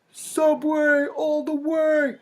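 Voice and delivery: high, slow bro chant; mock dude. A guy loyal to Subway, slow high chant. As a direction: sell it like a Subway fan. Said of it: "a guy who's like".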